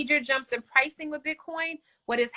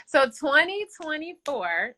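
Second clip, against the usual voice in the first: normal talking voice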